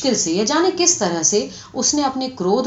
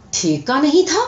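high-pitched angry question; acted indignant disbelief; incensed disbelief; 6 semitones higher, 3 dB louder than usual. A narrator's reading. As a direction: demand it, rising in outrage. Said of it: "آنکھوں سے چنگاریاں سے نکلنے لگی".

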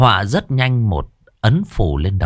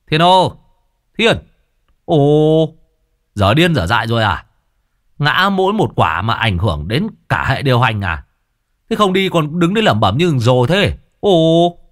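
loud, high calling out across the yard; shouting from the gate; 5 semitones higher, 3 dB louder than usual. A narrator's reading. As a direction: yell at Thiên from outside. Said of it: "giọng nói từ ngoài cổng dội vào". (giọng quát gọi to từ ngoài cổng)

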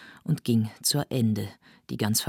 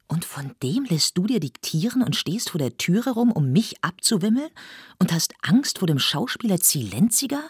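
higher, sharper indignant questions; indignant reproach; 6 semitones higher, 3 dB louder than usual. Acted. Lotte scolds Lenhoff for blocking the door, indignant and higher. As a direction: throw his cowardice back at him.

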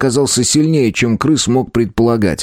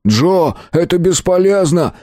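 shouting; 7 semitones higher than usual. A narrator's reading. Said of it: "крикнул он товарищу".

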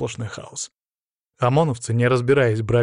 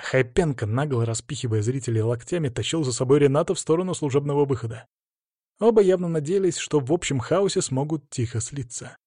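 usual speaking voice

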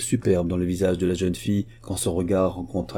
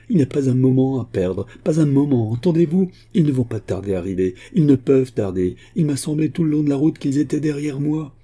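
higher-pitched, alarmed warning; breathless urgency; 6 semitones higher, 4 dB louder than usual. A narrator's reading. Daughter warns her father he is in danger, breathless urgency. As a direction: press him to flee, phrase after phrase. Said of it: "répondit la jeune fille haletante".

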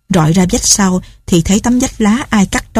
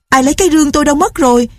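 panicked cry; 8 semitones higher, much faster than usual. A narrator's reading. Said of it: "kinh khủng la bài hải".

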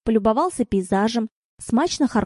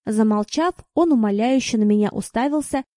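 plain reading voice

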